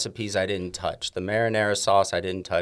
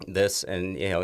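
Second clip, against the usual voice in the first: ordinary talk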